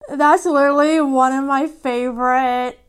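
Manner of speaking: normal talking voice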